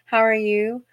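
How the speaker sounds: sweet Southern sing-song hello; sweet Southern greeting. Shown as a demonstration. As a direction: greet a customer sweetly, pitch lifted. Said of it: "I'm sweet".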